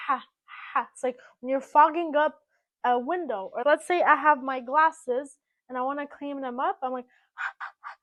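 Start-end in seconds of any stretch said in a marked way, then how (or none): 0.1-0.9 Ha with a strong breathy throat h; breathy h starts. Shown as a demonstration. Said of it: "seven is like a breathy H". 7.3-8.0 ha as breath fogging glasses; breathy h starts. Shown as a demonstration. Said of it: "That same sound".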